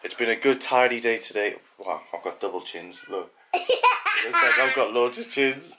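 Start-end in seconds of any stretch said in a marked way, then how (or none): 3.5-5.8 much higher, slow giggling voice; laughing. Real, not acted. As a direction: squeak it out while laughing. Laughing at his double chins.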